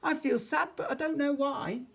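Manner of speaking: put-on high, plaintive child's voice; sad sighing child. A narrator's reading. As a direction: lift it high, a little woeful. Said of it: "with a great big sigh".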